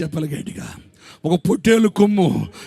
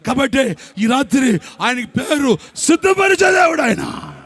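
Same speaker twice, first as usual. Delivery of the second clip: preaching shout; 6 semitones higher than usual. Acted. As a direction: shout the name of God out. Preacher shouts God's name.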